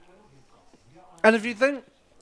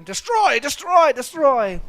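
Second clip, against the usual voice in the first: loud, high villain's battle cry; repeated mock shouts, pitched high and loud; mock yelling; 7 semitones higher, 9 dB louder, slower than usual. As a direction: yell it like a hammed-up villain ordering destruction. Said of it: "the guy was yelling".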